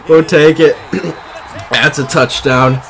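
loud, gleeful celebration; triumphant. Real, not acted. Streamer celebrates scoring in his game, loud and triumphant. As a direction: call it out loud, savouring the win.

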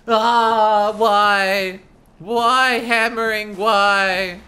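loud, drawn-out whining why; anguished wail. Real, not acted. Player wailing at the endless hammering, loud anguish. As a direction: cry out at the game in mock despair.

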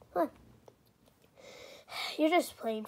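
ordinary delivery

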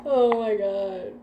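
ordinary delivery

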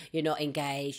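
ordinary delivery

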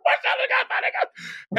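very high-pitched mock scream; retold scream. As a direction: imitate a teller shrieking news to everyone. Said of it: "was screaming to the people".

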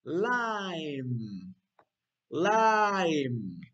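"lime" belted long with a falling glide; slow, emphatic "lime"; model for repeating. Shown as a demonstration. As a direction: stretch each "lime" for children to echo. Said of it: "Repeat after me".

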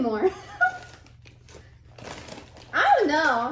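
chewing while talking; talking between bites; mouth full. Real, not acted. Seen 2: Left speaker reaches into bag, then hand at mouth eating while talking.

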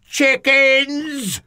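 high, loud taunting bellow; Goliath bellowing. A narrator's reading. Giant taunts the silent soldiers, bellowing. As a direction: roar it out at the whole army. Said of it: "Goliath bellowed".

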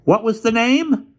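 high, slow, thin clerk voice; a thin, fussy little voice; station master voice. A narrator's reading.

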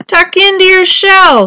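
high, loud instruction; calling instructions. A narrator's reading. Turtles below advise Tudley, calling up. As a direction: call it up to someone above. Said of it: "said the turtles".

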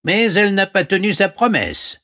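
very high frog voice; squeaky-high frog offering help; talking frog. Acted. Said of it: "répondit la grenouille". (voix de grenouille très aiguë)

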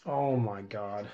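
low, very soft defeated grumble; real deflated resignation; dejected. Real, not acted. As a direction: sink into quiet self-pity. Lamenting repeated losses.

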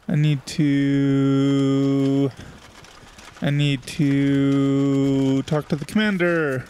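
dramatic slow. Pretend. Dramatic slow reading of game line.

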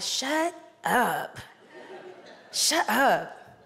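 firm, measured command without yelling; stern. A narrator's reading. Jesus answers the possessed man, sternly without yelling. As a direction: rebuke sternly, voice held back. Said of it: "Jesus said sternly. He didn't yell".

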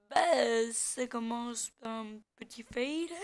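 ordinary talk

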